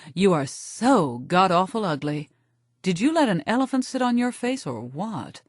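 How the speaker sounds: a lightly voiced sneering diva insult; hint of a catty queen. Brandy mocks the narrator in the doorway, lightly voiced. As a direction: toss it off like a haughty queen. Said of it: "Brandy Alexander ... says".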